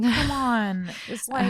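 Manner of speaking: mock exasperation. Mock scolding the tipster.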